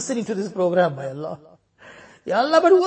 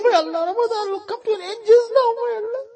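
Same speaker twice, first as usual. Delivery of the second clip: higher, voice cracking with tears; tearful plea; 8 semitones higher than usual. Real, not acted. Imam weeping that Ramadan is ending soon. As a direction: cry out the urgency.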